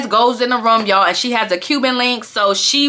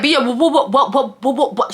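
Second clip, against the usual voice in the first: mock stutter; 2 semitones higher than usual. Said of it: "She started stuttering".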